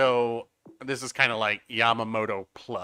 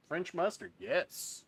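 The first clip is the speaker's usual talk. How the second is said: higher and softer, savoring the name; amused disbelief; 6 semitones higher, 7 dB quieter, slower than usual. Shown as a demonstration. Streamer confirming he read the odd name right, higher and softer. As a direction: repeat it up high and hushed, half amused.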